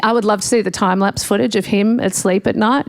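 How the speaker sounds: normal talking voice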